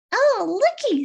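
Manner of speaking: high, loud delighted exclamation; Winnie delighted. Acted. Hen spotting Mister Johnson, high and loud delight. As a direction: squeal it like a thrilled little hen. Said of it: "said Winnie".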